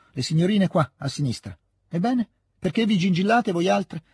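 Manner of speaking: lightly voiced old hostess scolding maids; brusque old lady. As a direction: hurry the maids along with a mild rebuke. (lieve rimprovero alle cameriere)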